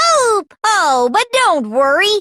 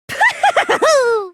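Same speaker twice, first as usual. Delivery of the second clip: mocking laugh; 8 semitones higher than usual. Villain laughs at Kyoko.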